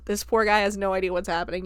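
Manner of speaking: ordinary talk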